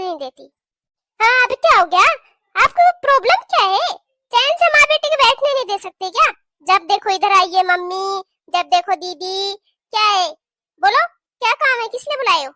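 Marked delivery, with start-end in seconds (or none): 1.2-6.3 high, loud furious yelling; angry shout. Acted. Sister-in-law yelling at bhabhi for calling her, furious shout. 6.7-12.5 irritated scolding. Acted. Continues the quarrel.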